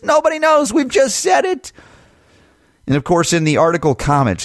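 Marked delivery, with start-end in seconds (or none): 0.0-1.7 high, sarcastic put-on voice; mock them in a high voice. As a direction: parody the officials, squeaky and smug. Mocking the authorities' claim.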